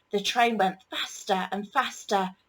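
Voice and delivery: ordinary delivery, nothing marked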